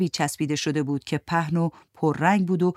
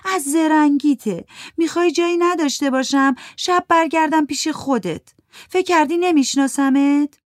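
sighing accusation; 10 semitones higher, 5 dB louder than usual. Acted. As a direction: throw it at him with a weary sigh. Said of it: "ماندانا آه کشید و گفت". (سرزنش آهسته و تلخ، بالاتر و بلندتر)

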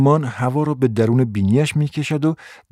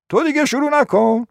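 very high-pitched scolding; high, fed-up reproof; exasperated grandmother. Acted. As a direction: cut the child off in a high voice. Grandmother snaps at Elsa's question.

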